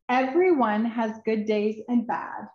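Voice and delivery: ordinary delivery, nothing marked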